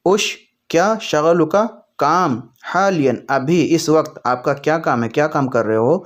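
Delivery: usual speaking voice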